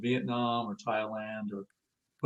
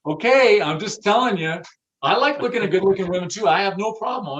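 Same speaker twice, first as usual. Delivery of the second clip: high, loud, quick insistence; emphatic retort; 6 semitones higher, 6 dB louder than usual. Retold or imitated. Boss defending his rule to staff, loud and fast. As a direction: press the point with raised voice. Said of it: "I'm like".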